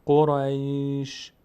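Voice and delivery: "قریش" very slowly, trailing into a long sh; audible final shin. Shown as a demonstration. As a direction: draw out "قریش" and let the bare ش sound on. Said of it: "یک صدای شینی آمد".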